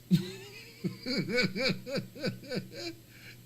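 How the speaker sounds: high-pitched burst of laughter. Real, not acted. Streamer cracking up at his Spirit airline joke, laughing high. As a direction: laugh hard at your own joke.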